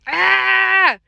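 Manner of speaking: high, loud, sustained "ah"; hollered scream. A narrator's reading. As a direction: cry out long and high, storybook panic. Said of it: "I screamed and I hollered".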